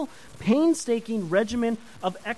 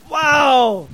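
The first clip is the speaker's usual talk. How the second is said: a sudden loud wow, caught off guard; real surprise; 7 semitones higher, 12 dB louder than usual. Real, not acted. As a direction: blurt it out, embarrassed by the slip. Realizes rugby term gaffe.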